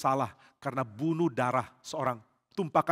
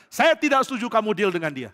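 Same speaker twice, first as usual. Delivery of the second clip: raised, louder, with an angry push; angry objection; 6 semitones higher, 7 dB louder than usual. Acted. Joab objects to the king's deal with Abner, angrily. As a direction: Joab refuses the king, pushing hard against him. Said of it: "Yoab marah sekali".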